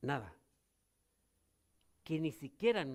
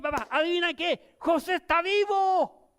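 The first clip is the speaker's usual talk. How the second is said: excited news; 9 semitones higher, 8 dB louder than usual. Acted. Brothers tell father news.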